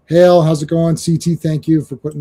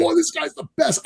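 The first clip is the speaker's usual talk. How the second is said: high, softer fanboy gushing; retold fan excitement; 11 semitones higher, 5 dB quieter than usual. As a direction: re-enact being starstruck, pitched way up. Retold fan reaction to the actor.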